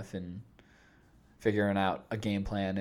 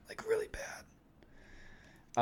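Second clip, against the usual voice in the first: whispering; 11 dB quieter, much slower than usual. Real, not acted. Longing for the movie.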